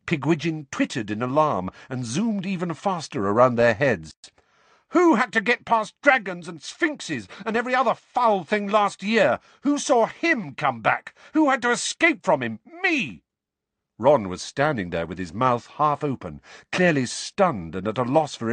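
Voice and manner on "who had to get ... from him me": high, loud, indignant shouting; furious outburst. A narrator's reading. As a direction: let the pent-up resentment pour out in a high-pitched rant. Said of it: "finally burst their boundaries".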